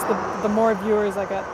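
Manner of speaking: usual speaking voice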